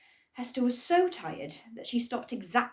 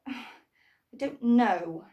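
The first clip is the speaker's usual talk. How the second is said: sighing; 3 semitones higher, 6 dB louder, much slower than usual. Acted. Hester sighs uncertainly.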